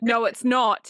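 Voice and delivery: firmer denial. Retold or imitated. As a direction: push the denial harder. Repeated retort, louder.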